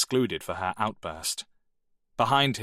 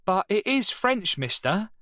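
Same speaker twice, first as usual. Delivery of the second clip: a much higher mock-innocent shout; 12 semitones higher, 3 dB louder than usual. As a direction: call out cheekily. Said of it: "shouted Dale".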